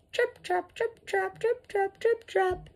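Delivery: high, light trip-trap patter; dainty hooves tapping across a bridge; little goat hoof steps. A narrator's reading. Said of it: "feet made that sound on the bridge".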